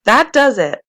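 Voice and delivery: beleaguered sergeant shouting at the protesters; shouted. A narrator's reading. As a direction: bark it, at the end of his patience.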